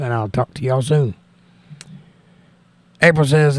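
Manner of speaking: ordinary talk, nothing marked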